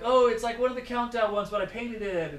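high, loud offhand fib; mock casual bluff. Angler fibbing to curious strangers about his lure, high and breezy. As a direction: toss off the lie like nothing.

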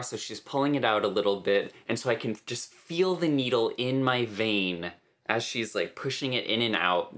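ordinary talk

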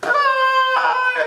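very high yelp of delight; excited shriek. Real, not acted. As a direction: squeal it out. Outburst at the driver.

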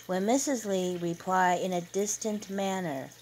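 ordinary delivery, nothing marked

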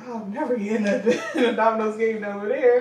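laughing through the quote. Retold or imitated. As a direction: let laughter break the words. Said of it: "he was like".

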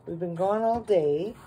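normal talking voice

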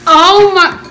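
gasping from the spice heat; reacting to burning hot noodles; mouth burning from spicy food. Real, not acted.

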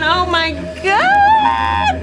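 high, drawn-out horrified oh my God. Real, not acted. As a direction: let the fear stretch the words out slow and high. Spotting bigger spider.

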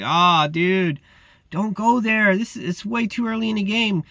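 high, pleading mock dismay; exasperated reproach. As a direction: plead with a hothead to back off. Acted reproach to pushy guard.

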